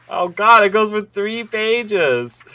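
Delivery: high, loud, drawn-out laughing groan; amused dismay. Real, not acted. Streamer finding the smutty book runs long, amused dismay. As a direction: wail it slowly in real amused dismay.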